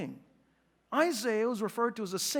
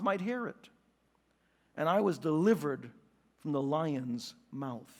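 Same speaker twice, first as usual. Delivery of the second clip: measured, lower reading voice; scripture reading; 5 semitones lower than usual. A narrator's reading. Preacher reads Paul's words from Second Timothy, solemn reading. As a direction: read solemnly, phrase by phrase.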